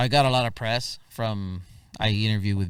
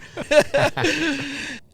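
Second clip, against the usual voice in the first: high laugh; 4 dB louder than usual. Real, not acted. Laughs at own story.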